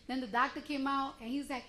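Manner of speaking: normal talking voice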